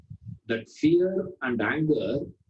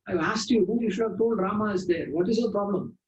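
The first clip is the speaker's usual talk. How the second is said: high, put-on voice of a cross doorkeeper; scolding doorkeeper; 10 semitones higher than usual. Doorkeeper scolding the visitor at the door in a high voice. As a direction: snap at the stranger in a higher pitch.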